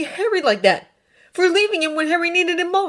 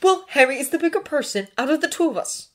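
fast, loud, raised retort; snapped; 10 semitones higher, 6 dB louder, faster than usual. A narrator's reading. Draco conceding sarcastically to Hermione, snapping. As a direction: bite back quickly. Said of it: "Draco snapped".

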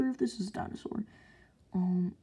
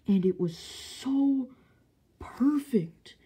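awed; 5 semitones higher, much slower than usual. Real, not acted. Awed about the figure.

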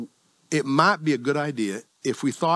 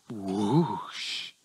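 long, soft airy whoosh; imitated whoosh; 5 semitones lower, 7 dB quieter than usual. Shown as a demonstration. Preacher showing how resisting temptation feels, drawn-out whoosh. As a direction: draw out a rushing whoosh, softly.

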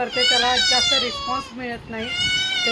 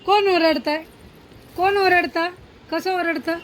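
playful mock scolding; 5 semitones higher, 4 dB louder than usual. Real, not acted. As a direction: tease the little shrieker. Mock scolding the shrieking baby.

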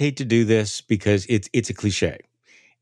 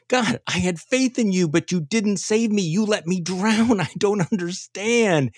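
pitched-up, slowed plea of a bewildered drowned man; pleading drowned man; 8 semitones higher than usual. Acted. Drowned man in heaven complaining to God, pitched-up bewildered plea. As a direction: whine your hurt confusion at God, laughing at the end.

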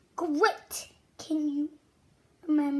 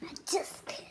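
soft playful whisper; 7 dB quieter, much faster than usual. Acted. Soft teasing whisper, admitting the joke to kids.

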